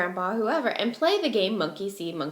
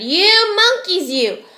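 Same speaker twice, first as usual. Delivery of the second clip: much higher and louder shout, slow on monkeys; angry shout; 8 semitones higher, 9 dB louder, slower than usual. Acted. Furious peddler shouts at the monkeys for his caps. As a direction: shout at them, furious and stamping. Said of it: "stamped both his feet and shouted".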